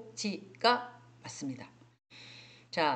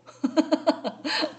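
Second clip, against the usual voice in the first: high, loud burst of laughter; 7 semitones higher, 5 dB louder than usual. Real, not acted. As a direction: laugh at your own confession. Laughs at own self-description. (크고 높게 터지는 웃음)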